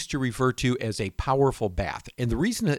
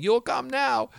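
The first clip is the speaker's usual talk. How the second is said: much higher-pitched mock scream; retold scream; 13 semitones higher, slower than usual. Husband mimics his wife yelling for him, high mock scream. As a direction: imitate her yelling up from downstairs. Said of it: "And she's screaming".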